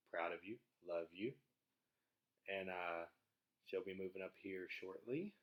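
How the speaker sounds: ordinary talk, nothing marked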